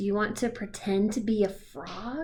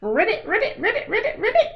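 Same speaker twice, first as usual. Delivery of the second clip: high, rising, laughing ribbits; giggly croaking; 10 semitones higher, 4 dB louder than usual. Acted. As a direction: croak it through giggles, getting higher and louder.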